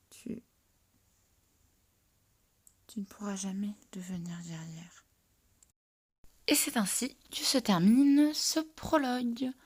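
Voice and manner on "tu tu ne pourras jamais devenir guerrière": hushed grave verdict; sad whisper. Acted. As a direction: deliver the sad news softly. Healer's verdict.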